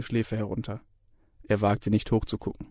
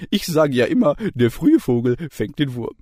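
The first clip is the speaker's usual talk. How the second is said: higher, louder proverb-loving lecturer; jovial lecturer; 4 semitones higher, 5 dB louder than usual. A narrator's reading. Lecturer lectures the student, jovially loud. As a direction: deliver the proverb with relish.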